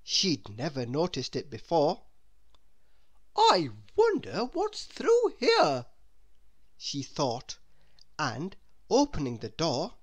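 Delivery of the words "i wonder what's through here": high, loud, self-satisfied lady's voice; a higher, louder voice full of self-importance; haughty Miss Splendid. Acted. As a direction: wonder aloud like a grand lady.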